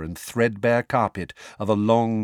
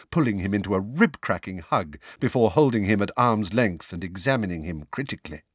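plain reading voice

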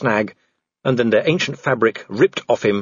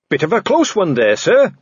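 bright, higher rescuer's greeting; cheerful; 6 semitones higher, 4 dB louder than usual. A narrator's reading. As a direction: chirp it cheerily to a superior. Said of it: "said a voice cheerfully".

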